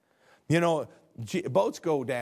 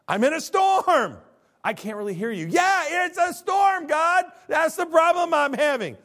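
much higher, louder yell over the wind; shouting over storm; 13 semitones higher, 8 dB louder than usual. Pretend. Pastor acting himself yelling to God through a storm. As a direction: strain to be heard in the gale.

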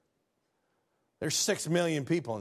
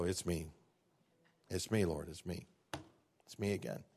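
much softer, lower, sheepish confession; meek and small; 10 semitones lower, 8 dB quieter than usual. Shown as a demonstration. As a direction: shrink down like a child owning up again. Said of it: "Sometimes I come before God and go".

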